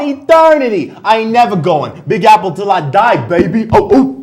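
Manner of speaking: high, loud Big Apple swagger; loud hammed-up pride; loud bragging. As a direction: shout your loyalty to the city. Proud New Yorker outburst.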